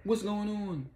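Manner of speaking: high, frantic imitated panic; panicked struggle. Vicky grabbed by the guys, voiced high and frantic. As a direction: pull free in a panic. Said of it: "So Vicky was like".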